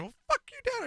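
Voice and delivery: mock defiant kid. Imagined retort to father.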